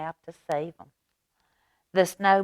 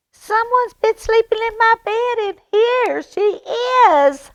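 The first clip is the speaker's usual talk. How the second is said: put-on very high, louder baby bear squeak; 9 dB louder than usual. Baby Bear finding Aluki in his bed, very high squeak. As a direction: squeak it out, tiny and thrilled.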